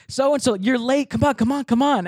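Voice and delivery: high, urgent hurrying voice; imitated receptionist. Woman at desk hurrying late candidate, high urgent voice. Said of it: "the woman at the desk".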